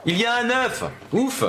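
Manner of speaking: sudden high-pitched cry of surprise; excited exclamation. Real, not acted. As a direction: burst out as the egg appears. Discovers an egg, exclaims. (cri de surprise excité, voix aiguë)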